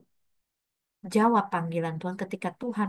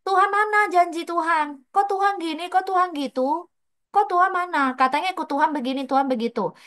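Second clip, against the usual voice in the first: high, loud, pleading protest; complaining cry to God; 9 semitones higher, 5 dB louder than usual. Pretend. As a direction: cry out at God, demanding answers. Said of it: "kalian akan teriak". (teriakan protes yang tinggi dan keras kepada Tuhan)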